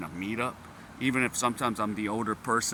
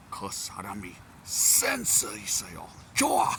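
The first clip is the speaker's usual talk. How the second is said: Korean coworker impression; 3 semitones lower, much faster than usual.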